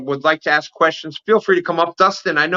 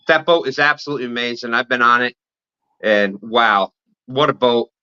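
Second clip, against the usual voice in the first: ordinary talk, nothing marked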